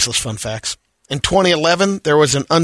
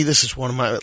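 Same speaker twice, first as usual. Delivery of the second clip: ordinary delivery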